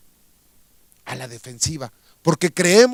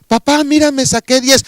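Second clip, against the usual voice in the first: put-on higher, louder eager child voice; 8 semitones higher, 7 dB louder than usual. Child eagerly showing father a good grade, higher and louder. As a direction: call out proudly like a small child.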